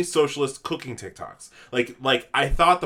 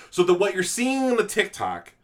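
ordinary delivery

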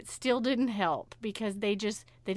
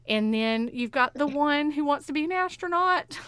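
ordinary delivery

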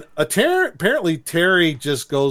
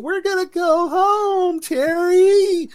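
very high, drawn-out, louder cartoon cry; mock excited call; 4 dB louder, slower than usual. Calling out as cartoon character.

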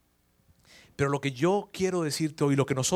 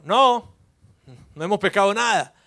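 shouting back; 4 semitones higher, 8 dB louder than usual. Retold or imitated.

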